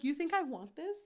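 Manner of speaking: frustrated mock protest; acted frustration. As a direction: imitate an exasperated captor pushing back. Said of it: "he gets so frustrated".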